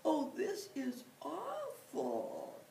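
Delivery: weeping bear confesses his grumpiness; sobbing bear. Acted. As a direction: cry it out, broken and breathy. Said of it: "the bear began to cry big tears".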